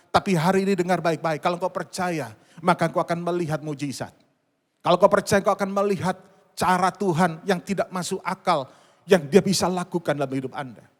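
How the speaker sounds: loud, emphatic sermon exhortation; forceful preaching. Real, not acted. Preacher promising the congregation a miracle, forceful exhortation. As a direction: press each phrase home forcefully.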